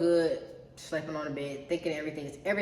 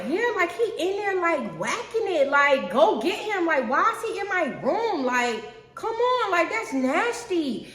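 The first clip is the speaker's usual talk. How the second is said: worked-up complaint; 12 semitones higher, 6 dB louder, slower than usual. Retold or imitated. Telling mom about brother.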